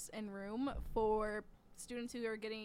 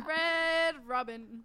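mock ad; 3 semitones higher, 7 dB louder, much slower than usual. Mock ad jingle.

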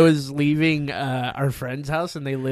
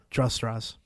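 deadpan; 3 semitones lower, 7 dB quieter than usual. Retold or imitated. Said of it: "some guy's like".

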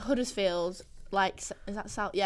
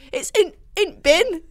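loud, high, slow Bradford dialect; hammed-up Bradford housemate; Bradford accent; 8 semitones higher, 9 dB louder, slower than usual. Imitating housemate's dialect to friends, high loud Bradford accent. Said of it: "She goes like".

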